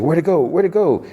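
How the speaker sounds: high-pitched imitation of panic; panicked. Boy loses sight of creature, acted high panic. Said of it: "And Slightly panics".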